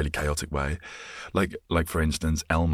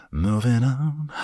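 mock announcer; 10 semitones higher, 5 dB louder, slower than usual.

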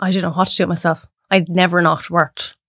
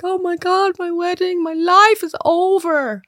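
high, slow mock sob of a bride; mock wailing bride; 10 semitones higher, slower than usual. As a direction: ham up a bride whose wedding is ruined. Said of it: "they're crying and they're like".

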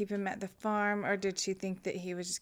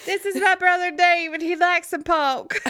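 put-on Southern accent; 9 semitones higher, 10 dB louder than usual. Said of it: "he's turned Southern".

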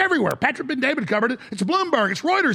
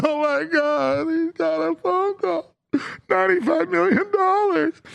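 high, slow mock sobbing; mock crying; 7 semitones higher than usual. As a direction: whimper it like a hammed-up crying witness. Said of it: "He starts crying".

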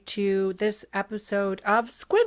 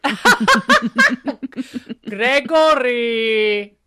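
mock Slovenian accent; 4 dB louder, slower than usual.

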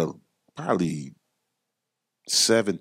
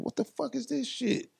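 high, softer mock outrage; retold anger; 8 dB quieter than usual. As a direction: imitate an angry customer, pitched way up. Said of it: "he got mad".

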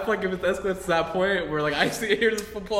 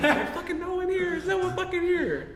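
very high, drawn-out mock-panicked denial; protesting wife; 13 semitones higher than usual. Acts wife denying to gunman.

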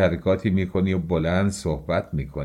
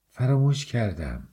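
higher-pitched, mildly surprised admission; 4 semitones higher than usual. A narrator's reading. Raskolnikov answering a question about his memory, mildly surprised. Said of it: "با تعجب گفت".

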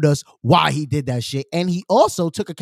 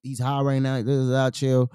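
ordinary delivery

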